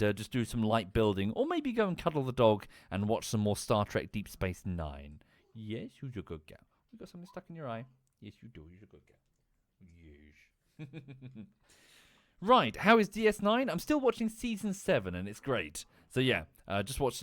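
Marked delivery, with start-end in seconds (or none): 5.5-11.5 very soft, cooing to a dog; doting dog talk. Real, not acted. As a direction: coo quietly to your beloved dog. Talking fondly to the dog.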